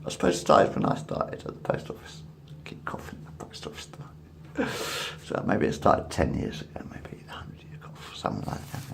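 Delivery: hushed whisper to camera. Real, not acted. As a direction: drop to a whisper. Drops into whisper mid-ramble.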